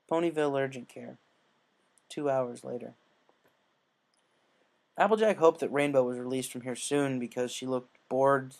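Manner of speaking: ordinary delivery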